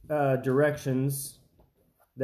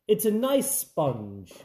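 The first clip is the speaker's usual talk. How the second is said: mock British baking judge, slow; a touch of a posh British judge; mock TV bake-off judge praising the sponge in a British accent; 2 semitones higher, much slower than usual. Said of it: "as they say on the Great British".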